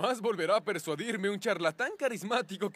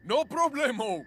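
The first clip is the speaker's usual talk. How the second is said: goofy; 8 semitones higher, 3 dB louder than usual. Acted. Goofy catchphrase.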